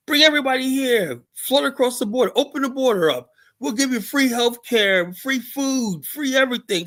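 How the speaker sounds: high mocking voice, sing-song giveaway promises; mocking the politician. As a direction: parody her like she's handing out candy. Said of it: "This lady ... wants to bankrupt the state".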